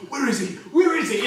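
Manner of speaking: a gunman bursting in, yelling for his target; gunman's shout. Acted. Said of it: "And he's just like".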